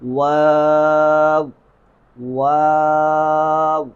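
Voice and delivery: "واو" with a very long level aa, then rounding to w; slow sustained model "واو"; correct waw. Shown as a demonstration. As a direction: stretch the alif in the middle of "واو". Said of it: "اسے پڑھنے کا صحیح طریقہ کیا".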